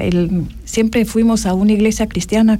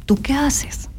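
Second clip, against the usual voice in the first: hushed, breathy question from the doctor; retold whispered question; 4 semitones higher, 4 dB quieter, slower than usual. Doctor retold asking Tatiana what she would do, whispered.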